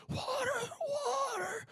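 thin, very high, faint croon for water; parched wanderer. Acted. Man walking through an old Western, faint parched plea. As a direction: mimic a desert straggler feebly calling out. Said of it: "Some guy walking on".

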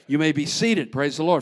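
normal talking voice

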